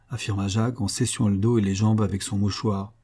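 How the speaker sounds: plain reading voice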